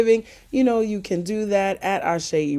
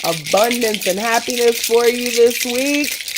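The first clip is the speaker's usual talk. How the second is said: louder, higher joyful benediction; preaching blessing; 4 semitones higher, 6 dB louder than usual. Acted. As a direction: bless them with fervor. Loud closing blessing.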